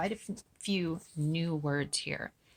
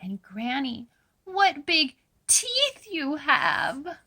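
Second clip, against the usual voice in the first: put-on little girl voice; 10 semitones higher, 4 dB louder, slower than usual. A narrator's reading. Girl questioning disguised wolf.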